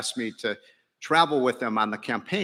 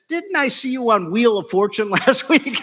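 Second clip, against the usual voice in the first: higher, louder, delighted woman's voice; excited woman; 10 semitones higher, 7 dB louder than usual. Retold or imitated. The fan mistaking him for a game show guest, higher and louder. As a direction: play her cheerful mix-up for laughs. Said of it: "She said".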